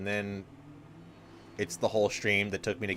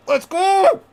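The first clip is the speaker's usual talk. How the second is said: high, loud pumped-up cheer; hype shout; 11 dB louder than usual. Real, not acted. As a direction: shout it to fire yourself up. Hyping up before boss.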